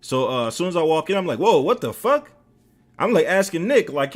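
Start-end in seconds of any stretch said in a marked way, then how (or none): 1.4-2.3 high, loud startled reaction; retold outburst of alarm; shocked exclamation. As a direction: burst out in disbelief. Retells his shocked entrance.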